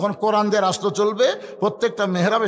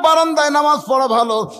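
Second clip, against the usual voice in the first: high, loud shepherd's yell; shouting; 10 semitones higher, 10 dB louder than usual. Acted. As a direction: shout it across the prayer hall. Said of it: "রাখাল চিল্লা বলে".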